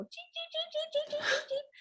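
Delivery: very high, soft imitation cheeping; duckling cheeps. Mimicking day-old ducklings, tiny high cheeps. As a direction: peep like a tiny chick. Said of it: "that was the noise".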